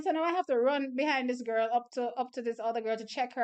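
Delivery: ordinary talk, nothing marked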